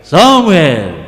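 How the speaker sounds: God calling in a big, deep voice. Shown as a demonstration. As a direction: drop the voice low and big for God's call. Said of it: "agak besar ya". (suara Tuhan yang besar dan dalam)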